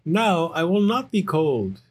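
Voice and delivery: high, cheerful little bear cub; excited Little Bear. Acted. Little Bear showing off his fur coat, high and excited. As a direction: play a delighted young bear, pitched well up.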